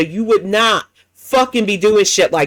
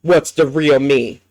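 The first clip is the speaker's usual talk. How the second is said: normal talking voice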